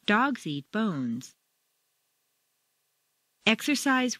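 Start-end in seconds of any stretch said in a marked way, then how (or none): 0.0-1.3 slow, DOGS and BONES stressed, "eat" kept lighter; stress on nouns. Shown as a demonstration. Teacher showing statement intonation, stressing the nouns DOGS and BONES. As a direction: lean on "Dogs" and "bones", falling at the end. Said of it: "you'll stress the nouns".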